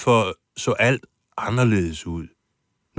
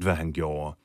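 ordinary talk

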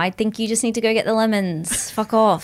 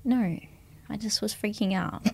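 whispered admission; 10 dB quieter than usual. Acted. Softly admitting.

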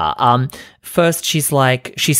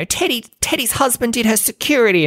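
high, mocking sing-song of rumor-mongers; mock gossip quote, high and scornful; 11 semitones higher than usual. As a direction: parody the people spreading the rumor, pitched way up. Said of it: "I'm not getting into this bullshit of".

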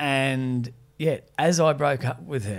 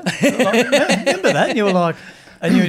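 laughing; 8 semitones higher, 8 dB louder than usual. Real, not acted. Laughing recollection.

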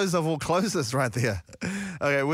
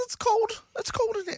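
much higher mock whiny voice; imitating Regan. Host mimicking a workmate complaining, high mock whine. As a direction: squeak it like a cold colleague. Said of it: "Regan's the one that goes".

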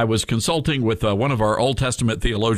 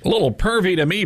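usual speaking voice

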